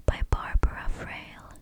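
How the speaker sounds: usual speaking voice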